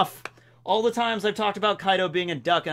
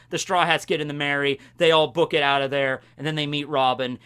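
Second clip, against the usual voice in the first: usual speaking voice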